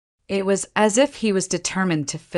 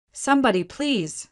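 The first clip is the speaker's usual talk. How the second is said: high, pleading call that drops off; desperate plea; 6 semitones higher than usual. A narrator's reading. Sinking woman begs anyone in the forest, desperate and high. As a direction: beg anyone to hear you. Trapped woman crying for help.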